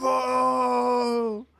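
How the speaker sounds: high, long wailing call; mock father mouse. Podcaster quoting An American Tail, wailing father's call. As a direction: wail the name in hammed-up anguish. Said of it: "One of them's called Fievel".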